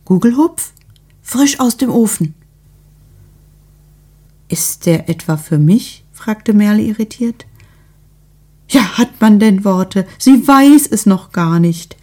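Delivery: a busybody overflowing with news; lightly voiced eager neighbour; gushing neighbour.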